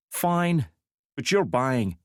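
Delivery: put-on a reluctant young woman giving in; young woman's voice. A narrator's reading.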